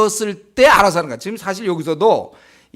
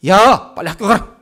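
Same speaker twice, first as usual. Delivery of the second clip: mock parent telling a child off; scolding call; 2 semitones higher, 7 dB louder than usual. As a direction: bark it like a parent hurrying a kid to school. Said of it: "뭐라 그럽니까?". (학교 가라고 다그치는 부모 흉내)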